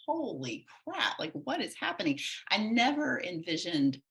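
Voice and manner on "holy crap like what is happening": shocked inner voice. Retold or imitated. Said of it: "I was just like".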